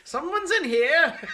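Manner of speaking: slow, high, laughing barker call; carnival barker. Retold or imitated. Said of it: "says it like a carnival barker".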